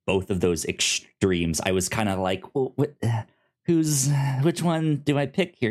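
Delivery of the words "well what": ordinary talk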